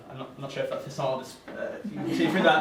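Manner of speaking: ordinary delivery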